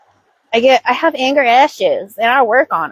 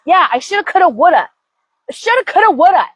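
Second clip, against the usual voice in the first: higher, sulky shoulda coulda woulda; mock sulky; 7 semitones higher, faster than usual. Inner self sulkily answering the scolding, mock sulk. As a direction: sulk it back sarcastically.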